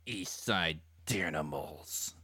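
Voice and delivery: slow, low, each syllable dropped separately; furious growl. A narrator's reading. Wounded gang boss names his enemies, furious growl. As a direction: seethe it out syllable by syllable. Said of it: "he growled in anger and fury".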